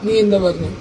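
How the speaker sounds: ordinary talk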